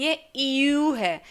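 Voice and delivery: यू said slowly with rounded lips like ई moving into ऊ; the German ü, slow and exact. Shown as a demonstration. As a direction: stretch out यू as a German ü. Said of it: "Hindi का ऊ या ऊ की मात्रा बढ़ाने से नहीं".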